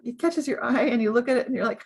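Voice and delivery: normal talking voice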